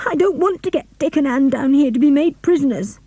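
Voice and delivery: high, tearful plea; begging through tears not to betray friends; sobbing refusal. A narrator's reading. George sobbing, refusing captors.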